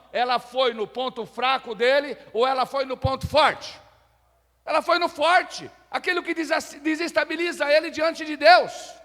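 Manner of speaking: high, loud preacher's cry; fervent preaching. Real, not acted. Preaches at full force.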